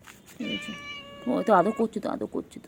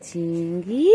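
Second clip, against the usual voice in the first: ordinary talk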